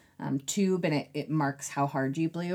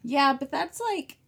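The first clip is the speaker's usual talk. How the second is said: thin squeaky sore throat voice; 7 semitones higher than usual. Real, not acted. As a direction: voice cracking up squeaky. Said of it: "when it gets squeakier".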